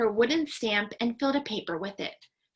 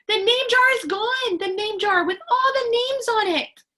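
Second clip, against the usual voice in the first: put-on much higher, louder child's outcry; alarmed shout; 11 semitones higher, 7 dB louder than usual. A narrator's reading. Ralph shouts at the teacher coming in, alarmed. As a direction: raise the alarm at full voice. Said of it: "Ralph shouted at him".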